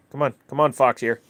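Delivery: loud call to the dog. Real, not acted. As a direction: call him over firmly. Calling the dog over.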